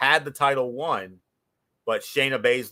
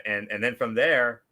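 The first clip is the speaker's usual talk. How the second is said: ordinary talk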